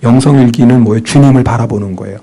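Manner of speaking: usual speaking voice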